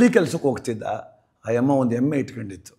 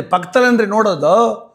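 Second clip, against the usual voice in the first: high, loud scoffing retort; a drunk doctor's loud scoff; dismissive doctor; 7 semitones higher, 7 dB louder than usual. Retold or imitated. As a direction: brush off the villager, loud and scornful. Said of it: "ಅಂದ".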